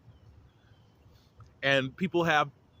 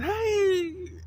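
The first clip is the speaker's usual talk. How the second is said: mock greeting squeal. Mimicking a guest greeting everyone, high mock squeal. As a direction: squeal hello like an over-excited guest. Acting out the greeting once more.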